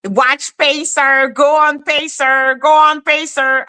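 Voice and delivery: high, insistent chant imitating another woman; mocking quote. Host imitating Lady Letitia urging a search, mocking high chant. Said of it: "talking bullshit, saying".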